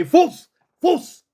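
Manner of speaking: high, sharp heel order; authoritative command. Shown as a demonstration. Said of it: "you got to say it with authority".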